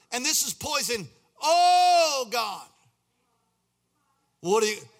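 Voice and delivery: high, loud pleading cry; desperate cry to God. Retold or imitated. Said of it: "I think it was more like".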